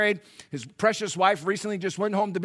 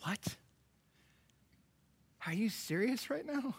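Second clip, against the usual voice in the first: mock disbelief; 4 semitones higher, 10 dB quieter than usual. Crowd's incredulous reaction.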